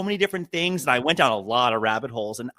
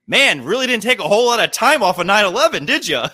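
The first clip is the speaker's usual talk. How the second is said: sarcastic jab; 5 semitones higher, 5 dB louder than usual. Retold or imitated. Sarcastic jab at landlord's husband.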